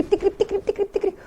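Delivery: teacher acting out running; mock running. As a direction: pretend to be running out of breath. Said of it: "لما تبقى بتجري".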